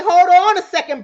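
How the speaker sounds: loud, forceful objection; calling a halt. Acted. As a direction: cut in loudly to stop someone. Acting out a questioner's objection.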